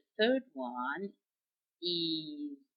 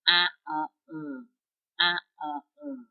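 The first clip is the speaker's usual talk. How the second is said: faster nasal drill; 5 dB louder than usual. Shown as a demonstration.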